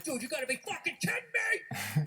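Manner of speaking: loud, high, drawn-out outraged yell; slow, incredulous sports-fan shout; screaming at the ref. Acted. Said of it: "you're screaming at the ref on T V".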